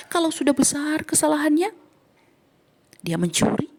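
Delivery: ordinary delivery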